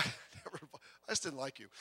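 soft, breathy laughing jab; teasing laugh. Real, not acted. Pastor ribbing his old friend Robert, laughing softly. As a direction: tease him softly through a laugh.

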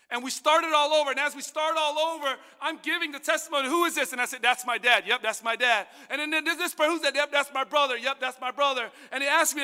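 loud, high preaching build; fervent testimony preaching; preaching at full force. Acted. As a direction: preach it rising with passion.

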